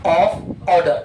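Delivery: every word drawn out slowly; slow dictation. Shown as a demonstration. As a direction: spell it out slowly for students. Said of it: "पंखा खराब हो गया तो क्या हो गया?". (धीरे-धीरे लिखवाते हुए)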